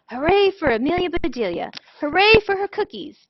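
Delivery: high, loud happy cheer; shouted cheer. Acted. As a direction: cheer her and the cookies. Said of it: "shouted the boys".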